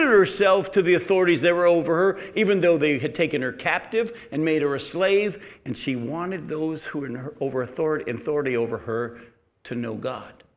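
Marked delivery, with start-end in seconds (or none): none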